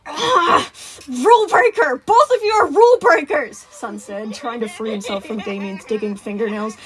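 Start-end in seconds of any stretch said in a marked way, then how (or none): 0.1-3.6 high, loud, exasperated accusation; frantic pitched-up telling-off; outraged scolding. Acted. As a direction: shout at two misbehaving kids. Sun scolds children climbing on him.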